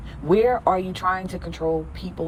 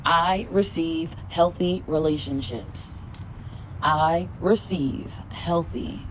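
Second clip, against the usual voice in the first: slow, soft repeated affirmation; 3 dB quieter, slower than usual. Shown as a demonstration. Three-peat affirmation for viewers, slow and soft. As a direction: draw each word out like a mantra. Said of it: "Say that shit out loud".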